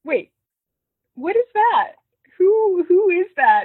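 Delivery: high, loud mock shock; imitating a startled, indignant double take; acted disbelief. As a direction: stop short, then demand it in loud disbelief. Acting Sophie's shocked reaction.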